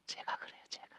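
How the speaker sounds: hushed whisper of confession; ashamed confession. Real, not acted. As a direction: admit it under your breath. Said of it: "부끄럽습니다". (부끄러운 듯 속삭이는 고백)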